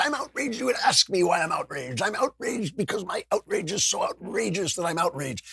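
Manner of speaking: high-pitched, hammed-up indignant rant; mock outrage. Host parodying endlessly angry people online, mock outrage. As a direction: pile on the fake indignation in a high voice. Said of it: "like, angry people".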